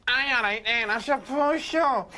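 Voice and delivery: high, slow, grudging agreement; a bored woman saying yes half-heartedly; reluctant woman. Retold or imitated. Woman at the club agrees to dance, high, slow and grudging. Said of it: "And she says".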